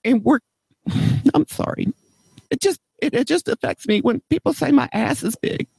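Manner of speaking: mock tearful, pitch breaking upward; getting emotional. Prank caller choking up to the print shop clerk, mock tearful. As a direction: voice cracking high, on the verge of tears. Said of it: "I'm getting all emotional again".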